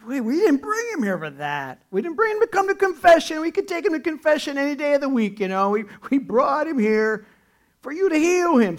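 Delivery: high, loud, insistent protest; indignant protest. Acted. The friends protest to Jesus, high and loud. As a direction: push back hard, voice up high. Men who brought him protest.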